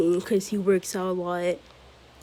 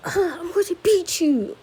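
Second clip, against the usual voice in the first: much higher put-on rival voice; squeaky challenge; 9 semitones higher than usual. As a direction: whine out the threat. Bakugo challenges Deku again.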